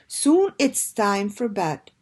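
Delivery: plain reading voice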